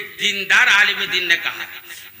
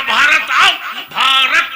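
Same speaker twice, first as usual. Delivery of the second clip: put-on higher and louder, like young men crying out at a cricket loss; retold shouting; 4 semitones higher, 6 dB louder than usual. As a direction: shout it out as the youths did at midnight. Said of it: "इतनी जोर से बोले".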